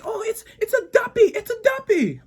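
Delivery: high, alarmed neighbor imitation; flipping out. Jamaican neighbor warning about a duppy, flipping out. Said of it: "she's flipping out".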